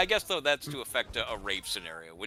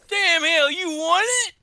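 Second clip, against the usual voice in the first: much higher and louder twangy Midwest gasp; amazed Midwest accent; 10 dB louder than usual. Retold or imitated. Neighbor marveling at the dad's win, thick Midwest accent. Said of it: "he affects this really thick Midwest accent".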